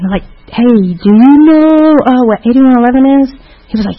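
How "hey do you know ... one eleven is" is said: ordinary delivery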